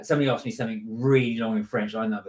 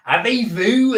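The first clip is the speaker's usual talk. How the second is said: put-on French in a broad, loud, high West Country accent; 10 semitones higher, 8 dB louder, slower than usual. Mocking the French teacher with a West Country accent. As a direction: ham up Bonjour in a thick West Country accent. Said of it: "strongest West Country accent you could do".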